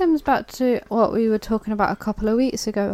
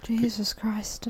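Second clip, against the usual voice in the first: slow, breathless whisper of shock; 8 dB quieter, slower than usual. Real, not acted. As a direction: whisper it in disbelief. Shocked at war deaths.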